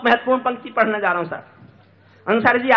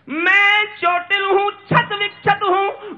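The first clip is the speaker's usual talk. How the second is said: very high, drawn-out poetic cry; keening, stretched declamation; anguished verse; 10 semitones higher, 4 dB louder, much slower than usual. Acted. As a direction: cry out the wounds slowly. Anguished lines recited again.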